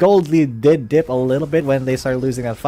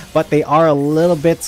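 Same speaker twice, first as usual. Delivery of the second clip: ordinary talk, nothing marked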